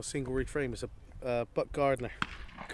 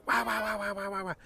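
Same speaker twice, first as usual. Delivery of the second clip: imitating hail call; 7 semitones higher than usual. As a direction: squawk it like a duck hail call. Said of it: "It's like they're literally going".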